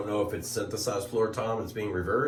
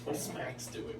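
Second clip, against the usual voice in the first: whisper; 5 semitones higher, 13 dB quieter than usual. Real, not acted. Whispering at child's bedtime.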